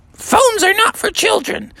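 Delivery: very high-pitched mom voice; refusing mom. Acted. Froggy's mom refusing her phone, high-pitched scolding. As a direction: put on a prim high voice to refuse.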